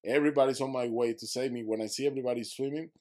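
normal talking voice

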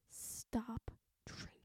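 slow hushed warning, barely voiced; whispered aside. Real, not acted.

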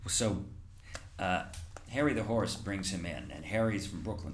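normal talking voice

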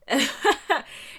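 high, loud burst of laughter; amused laugh. Real, not acted. Recalling her culture shock at school, amused laugh. Laughs at own puzzled reaction.

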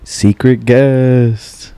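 slow, drawn-out show announcer; mock announcer. Host announces a secret guest, mock announcer. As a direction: stretch it out like a game-show host.

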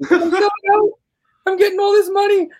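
gleeful sellout in high falsetto; Jordan Peterson. Retold or imitated. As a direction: squeal it as a mock impression. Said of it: "Jordan Peterson, like, selling out".

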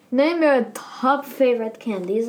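ordinary talk, nothing marked

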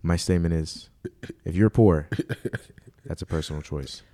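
low, solemn mock president; mock presidential speech. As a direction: gravely address the nation.

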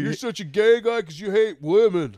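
high, loud jeering voice; mocking heckler. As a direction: taunt like an imagined heckler. Voicing the heckler's mocking accusation.

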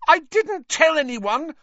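very high, loud, betrayed shriek. A narrator's reading. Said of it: "Hermione shrieked".

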